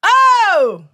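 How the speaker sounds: loud, high, startled oh; surprised. Real, not acted. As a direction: gasp out in delighted surprise.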